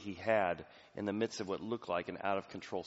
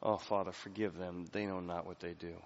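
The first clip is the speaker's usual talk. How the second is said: soft, lower, tender plea; gentle prayer; 5 semitones lower, 6 dB quieter than usual. Acted. Jesus on the cross forgiving, soft and lower. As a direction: murmur it like a dying man's prayer. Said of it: "he keeps saying this over".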